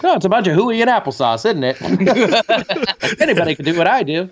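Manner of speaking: lightly voiced old Hollywood star, a bit quick; a hint of folksy old movie star, brushing it off; folksy old-timey guy. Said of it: "that could be another character".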